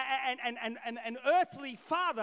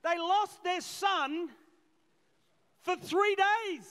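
slow, much louder and higher on three days; emphatic; 5 semitones higher, 7 dB louder, slower than usual. Real, not acted. Preacher stressing the loss to church, slow and loud. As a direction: stretch it out with weight.

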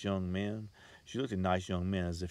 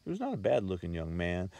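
plain reading voice

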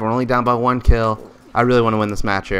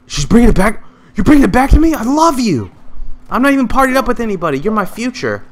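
thrilled high gushing; overjoyed, pitched up and fast; excited; 11 semitones higher, 4 dB louder than usual. Real, not acted. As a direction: gush with delight, way higher. Teammate returns his sniper.

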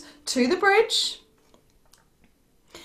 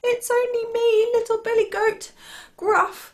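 high little goat voice; tiny billy goat; 11 semitones higher than usual. Acted. Little billy goat answers the terrible troll, tiny and high. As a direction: answer in a small, high-pitched voice.

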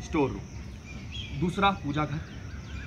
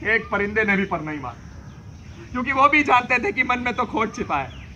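passionate lament; 7 semitones higher than usual. Acted.

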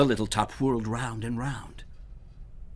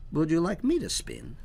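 higher, innocent Herschel; sly offer; 9 semitones higher, 4 dB louder than usual. Acted. Herschel innocently offering the goblin a turn. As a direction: offer sweetly, hiding the trick.

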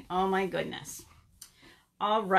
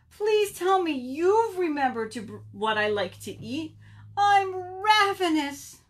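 desperate pleading; 9 semitones higher, 4 dB louder than usual. Acted. Ravenous Bird begs Raccoon for food, pleading. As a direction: beg high, wailing the last word.

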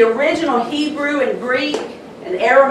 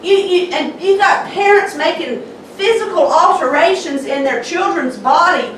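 fiery preaching; 5 semitones higher than usual. Acted. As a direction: pound the pulpit with rising fire. Preaching at full force.

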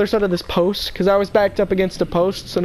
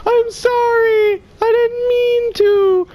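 put-on high, slow, slurred drunk lady apologizing; 14 semitones higher, 3 dB louder, much slower than usual. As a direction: whine it out like a tipsy woman saying sorry.

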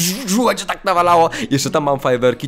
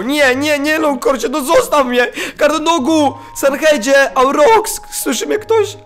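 calling for help; 9 semitones higher than usual. Real, not acted. Player calling teammates while the mob chases him. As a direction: shout names out, pleading for rescue.

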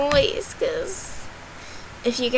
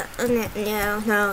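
normal talking voice

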